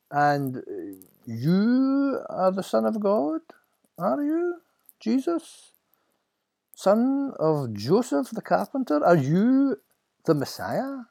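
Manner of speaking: very high, drawn-out incredulous questions; sneering high priest. Acted. High priest questioning the carpenter's son, sneering. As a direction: draw out each question mockingly.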